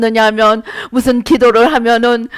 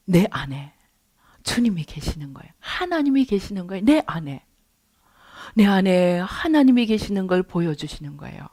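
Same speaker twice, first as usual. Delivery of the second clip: hushed awe; 6 semitones lower, 9 dB quieter than usual. Real, not acted. Hushed awe at God's presence.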